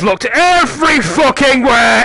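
high, loud, real frustrated yelling; exasperated shout. Real, not acted. Gamer who has looked everywhere in vain, exasperated shout. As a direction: yell it in sheer exasperation. Gamer cannot find the item.

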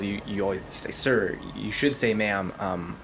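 ordinary talk, nothing marked

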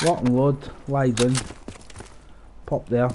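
ordinary delivery

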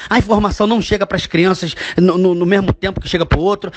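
ordinary delivery

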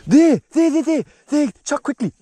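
high, loud, rapid-fire alert; real excited, high-pitched urgency; urgent calling out. Real, not acted. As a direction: call out urgently to a friend at a sudden sighting. Spots the fish, urgently calling friend.

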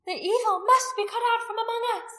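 high, loud fanatical demand; crying out. A narrator's reading. Priestess rousing her people against evil, crying out. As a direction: cry out for the evil's removal. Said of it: "Anomia cried out".

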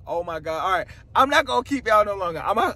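ordinary delivery

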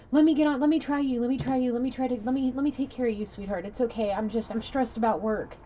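high, loud, hurried mock pleading; frantic pleading. Man trying to placate his angry sweetheart, acted-out frantic pleading. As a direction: imitate a flustered man begging and placating.

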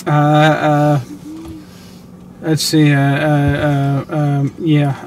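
halting, stuck on filler sounds; stuttering over um and uh. Real, not acted. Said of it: "I'm stuttering".

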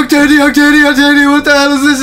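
loud, high, frantic calling of a name; panicked shouting. Real, not acted. Gamer calls to Octavia mid-scare, panicked shout.

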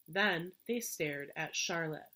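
plain reading voice

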